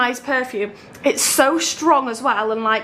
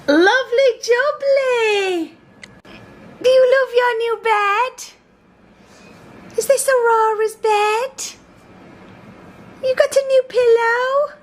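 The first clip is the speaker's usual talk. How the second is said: very high, drawn-out singsong; 11 semitones higher, slower than usual. Real, not acted. Talking to little one on her new bed, cooing baby talk.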